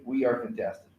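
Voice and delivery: mild echo of the group answering. Shown as a demonstration. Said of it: "So then they say".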